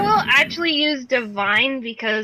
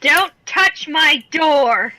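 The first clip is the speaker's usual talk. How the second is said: angry shout; 3 semitones higher, 4 dB louder, slower than usual. Acted. Angry barbarian shouting at door.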